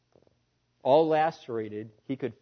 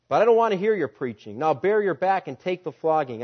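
angry master; 4 semitones higher, 7 dB louder, faster than usual. Acted. Cruel master orders a flogging.

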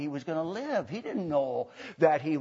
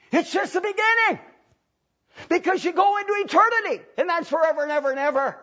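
high, loud pulpit proclamation; full-force preaching; 13 semitones higher, 7 dB louder than usual. Real, not acted. Preacher warns congregation of eternity, full-force preaching. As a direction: drive the warning home with a shout.

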